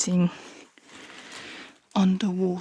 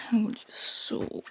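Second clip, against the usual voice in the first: whisper; 6 semitones higher, slower than usual. Real, not acted. Sorting the bed, whispering to viewers.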